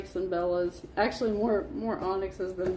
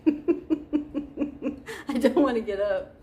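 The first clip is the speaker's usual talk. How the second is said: laughing; 3 semitones higher, much slower than usual. Real, not acted. Laughing as she sits down.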